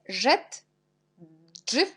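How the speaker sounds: usual speaking voice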